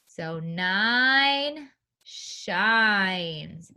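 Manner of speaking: nine shines drawn out very slowly; slow mnemonic. Shown as a demonstration. Teacher teaching a memory rhyme, very slow. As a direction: stretch each word so kids remember. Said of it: "the way I remember this is nine shines".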